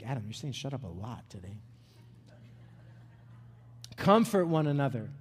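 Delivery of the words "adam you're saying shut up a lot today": low, much softer mock voice of friends; a hushed, lower ribbing aside; teasing friends. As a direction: quietly imitate the guys ribbing him. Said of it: "Guys are like".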